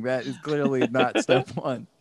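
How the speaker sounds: normal talking voice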